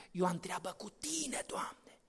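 hushed, fast whispered prayer; whispered plea. Acted. Moses begs God not to leave, in a whisper. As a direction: breathe it as a timid plea.

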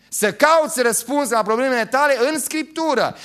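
high, loud preacher's call to the Bible; full-force preaching. Acted. Pastor urging his congregation, full-force preaching. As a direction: preach it out to the whole church.

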